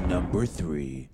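drawn out slow, building suspense; slow dramatic announcement. Acted. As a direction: tease it slowly like a countdown reveal. Announcing the next ranking.

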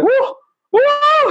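very high falsetto "woo" whoops; mock high-pitched whooping; imitated whoops. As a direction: mimic a guest whooping in squeaky falsetto. Imitating guest's whoops on zip line.

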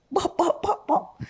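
high, soft bop bop mimicry; mock accent babble. Mocking someone faking Jamaican, high mock babble. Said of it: "him would have".

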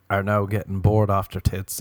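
usual speaking voice

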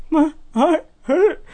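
high, slow mock sob; hammed-up heartbroken wail; super dramatic crying. Crying boy answering his confused brother, super dramatic. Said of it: "Super dramatic".

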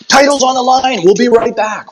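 hammed-up announcer cutting to commercial; mock TV announcer. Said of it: "you're cheapening your product where it's like".

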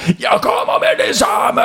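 high, slow, hammed-up shout. A narrator's reading. Tarmaren answers the kids' call, hammed-up shout. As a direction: bellow it like a hero on his way. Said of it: "Tarmaren råbte ind i røret".

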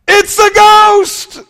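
high, loud frightened shout; terrified cry. A narrator's reading. Disciples in the storm boat, shouting in terror. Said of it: "and cried out in fear".

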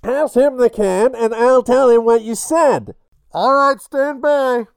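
muffled through the can; tin can phone. Acted. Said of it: "all muffled by the can".

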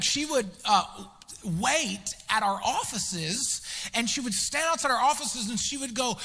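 ordinary delivery

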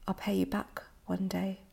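soft breathy whisper to close; a quiet vow, barely voiced. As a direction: let the last line fall to a whisper. Closing line of poem.

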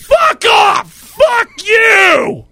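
very high, loud furious shout; angry yell. Retold or imitated. Homeless man yells at a stranger who called out, furious shout. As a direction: bark it at him like a crazed stranger.

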